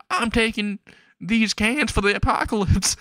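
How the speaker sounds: very high, crazy hillbilly voice; hammed-up high hillbilly cry; Magaki. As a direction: holler it as a fleeing loony hobo. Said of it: "has an actual crazy hillbilly hat on".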